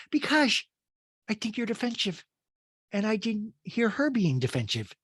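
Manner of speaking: put-on Rinna, high; Rinna, smug. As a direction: lecture her primly. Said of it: "Rinna goes".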